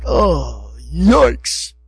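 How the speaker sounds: low, exasperated sigh of dismay; frustrated God. Retold or imitated. God looking at the golden calf, imitated frustrated dismay. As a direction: imitate a weary, let-down groan. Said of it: "Can you understand his frustration".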